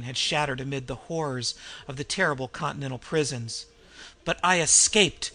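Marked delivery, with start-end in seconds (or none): 4.2-5.3 louder, rising cry of triumph; triumphant scream. A narrator's reading. The host gloats to Cain about his escape, triumphant scream. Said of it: "The scream rose triumphantly".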